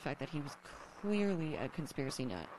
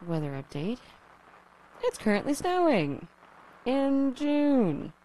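crackly lonely radio dj; 7 semitones higher, 9 dB louder, slower than usual. Acted. Broadcast weather report.

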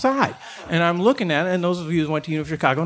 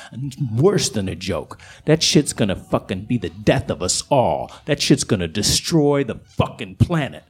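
a much lower, gruff streetwise voice; south side coyote; 9 semitones lower than usual. Acted. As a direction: sneer it down low like a tough coyote. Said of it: "south side coyote".

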